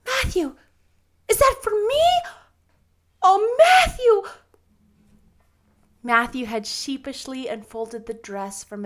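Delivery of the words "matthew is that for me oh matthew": put-on very high, loud girlish amazement; overwhelmed astonishment. Anne sees Matthew's gift for her, astonished. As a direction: overcome with joy, pitched way up.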